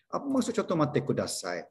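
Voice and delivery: ordinary talk, nothing marked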